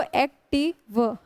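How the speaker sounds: Active slowly in three beats, एक - टी - व; syllable by syllable. Shown as a demonstration. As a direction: separate each part of active, ending on a lone व. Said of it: "तीन syllable वाले होते हैं".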